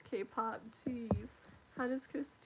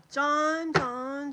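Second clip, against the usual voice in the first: loud call, much louder; 3 semitones higher, 10 dB louder than usual. Real, not acted. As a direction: call his name loudly across the room. Calling out to a viewer.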